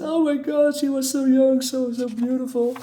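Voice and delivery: high, hammed-up wailing lament; over-the-top fake sobbing over a lost love; mock crying. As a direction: wail it like a grieving lover, overdone. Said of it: "this guy's crying".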